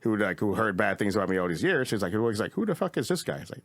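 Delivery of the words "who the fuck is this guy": high-pitched, lightly voiced sceptic; mock disbelief. Quotes a dismissive club booker.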